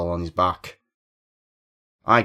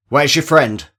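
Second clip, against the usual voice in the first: high, loud demanding shout; rattled shout; 7 semitones higher, 8 dB louder than usual. A narrator's reading. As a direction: bark the question at the girl. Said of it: "Maybe I shout".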